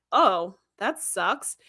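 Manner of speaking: slow, flat letdown drawl; slowed, sagging reaction; deflated. Retold or imitated. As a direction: let the air out of her, very slowly. Tasha voiced, deflated reaction.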